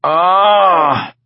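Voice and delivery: loud high groan of agony; loud pained groan. Acted. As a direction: cry out like you're badly hurt. Faking pain on the floor.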